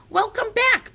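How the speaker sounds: high, brisk called greeting; mad scientist's shout. A narrator's reading. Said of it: "the mad scientist shouted".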